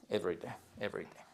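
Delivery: quick soft practice repetition; rapid whispered drill. Shown as a demonstration. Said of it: "Vous dites". (répétition rapide et très douce)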